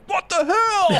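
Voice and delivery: high, incredulous what the hell; baffled outcry. Retold or imitated. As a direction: throw it up high in disbelief. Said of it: "we were like".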